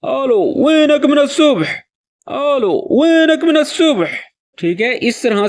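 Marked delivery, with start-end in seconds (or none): 0.0-1.6 anxious, calling into the phone; worried friend who vanished since morning. Shown as a demonstration. Caller on the phone to a missing friend, worried. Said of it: "میں دوبارہ آپ کو سکھا دیتا ہوں". 2.3-4.1 anxious, calling into the phone; worried friend who vanished since morning. Shown as a demonstration. Caller on the phone to a missing friend, worried. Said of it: "میں دوبارہ آپ کو سکھا دیتا ہوں".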